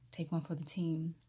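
slight lisp from trays; speaking with aligners in. Real, not acted. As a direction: teeth covered by trays. Seen 3: Aligners inserted just before; teeth look covered while speaking.

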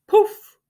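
high, loud puffed poof; feather poof. Acted. Owl puffs out his feathers, loud high poof. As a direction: puff it out suddenly.

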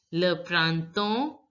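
printemps drawn out slowly as "pran-ton"; le printemps, slow, rising at the end; silent final letters. Shown as a demonstration. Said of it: "P and S are not going to be pronounced".

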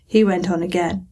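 ordinary delivery, nothing marked

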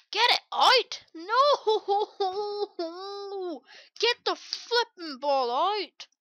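high, loud, drawn-out panicked shout; frantic yelling. Real, not acted. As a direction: yell desperately at your players mid-game. Yelling at players to clear.

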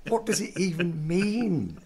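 high, drawn-out baffled question; slow, incredulous wail of confusion. Retold or imitated. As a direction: puzzle over it in a high voice. Baffled by absurd line, replayed.

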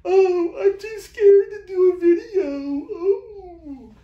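high, slow, crying mock whine; mock a frightened beginner; hammed-up sobbing coward voice; whiny scared newbie.

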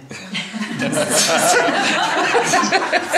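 high, loud laugh out loud; real amused laughter; burst of laughter. Real, not acted. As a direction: crack up at your own joke. Laughs at his own joke.

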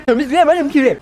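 ordinary delivery

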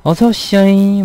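high, loud Jeolla welcome; Jeolla accent. Shown as a demonstration. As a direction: call out a Jeolla-style welcome. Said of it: "뭐 이런 거".

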